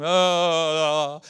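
a short loud roar for Goliath; hammed-up giant's yell; mock giant scream. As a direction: bellow it like a giant. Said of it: "Goliath is screaming".

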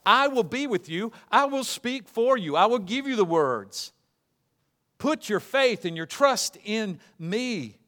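impassioned preacher speaking as the Lord; God rebuking Moses. Acted. Voices God answering Moses.

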